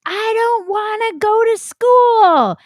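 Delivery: put-on high, slow whiny kid voice; childish tantrum. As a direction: wail it like a six-year-old refusing school. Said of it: "I threw a tantrum".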